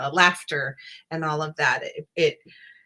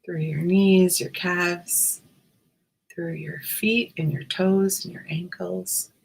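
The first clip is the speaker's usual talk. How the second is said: guided meditation. Acted. Guided meditation body scan.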